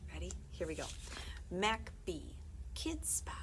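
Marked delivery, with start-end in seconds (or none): none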